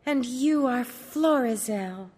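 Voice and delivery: high, slow, sweet princess voice; cartoon princess. Princess voice in story.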